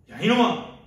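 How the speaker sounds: loud, high scolding yell; acted furious call-out; angry shout. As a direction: shout them down in indignation. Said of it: "막 소리치고 싶어요".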